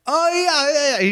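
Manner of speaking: very high, giggly brush-off; light mock-amused reply; imitated laughing. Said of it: "He laughs".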